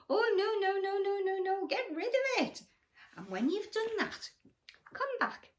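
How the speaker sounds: high, loud, hurried no no no; emphatic refusal. Acted. Crocodile refuses his bad tooth, loud and high. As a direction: wave it away in horror.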